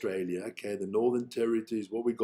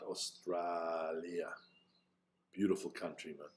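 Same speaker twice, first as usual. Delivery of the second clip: Hannibal Lecter voice; 8 semitones lower, 11 dB quieter than usual. Acted. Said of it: "You sound like fucking Hannibal Lecter".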